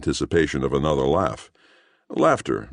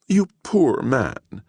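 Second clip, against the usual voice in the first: pitying; 9 semitones higher, much slower than usual. Acted. Woman pitying.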